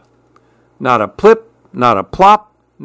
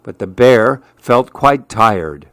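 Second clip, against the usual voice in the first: plain reading voice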